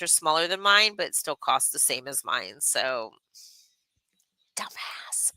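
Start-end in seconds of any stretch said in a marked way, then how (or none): 4.6-5.3 whispered jab under the breath; soft, whispery aside; muttered aside. Real, not acted. Streamer sneaking in a rude word about someone, whispered.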